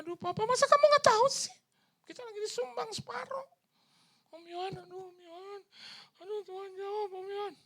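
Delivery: very high, loud squeal; wife squealing with joy; imitating excited wife. Wife answers pastor excitedly.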